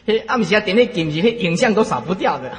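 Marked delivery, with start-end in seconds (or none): none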